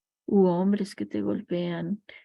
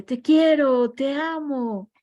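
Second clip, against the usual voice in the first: echo; 6 semitones higher, 6 dB louder, slower than usual. Retold or imitated. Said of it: "el eco responde".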